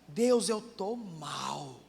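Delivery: slow, honest, simple confession; just tell God plainly, very slowly; humble sincere prayer; sincere plea. Shown as a demonstration. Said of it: "Fala mesmo".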